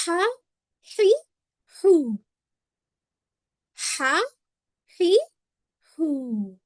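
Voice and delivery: ہا، ہی، ہو with a strong airy ح; breathy h drill. Shown as a demonstration. Teacher demonstrates the ح sound for students. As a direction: push air for each ح sound. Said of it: "ہا کی آواز کیسی ہوتی ہے".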